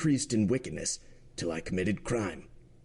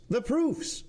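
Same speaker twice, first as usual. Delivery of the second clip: much higher, rising repeat; insistent repeat. Acted. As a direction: pushes the demand up a notch, higher still. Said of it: "said the president".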